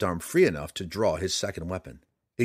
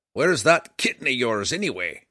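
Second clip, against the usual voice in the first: higher, louder gruff dwarf; gruff gravelly dwarf voice; 4 semitones higher, 6 dB louder than usual. A narrator's reading. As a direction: bark it like a cranky old dwarf. Dwarf Bruenor speaks.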